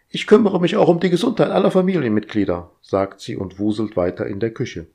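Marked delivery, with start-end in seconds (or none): 0.1-2.7 high, bossy, busy voice; fussy household robot. Acted. Household AI fussing at Roger, high and bossy. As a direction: nag like an overeager house AI.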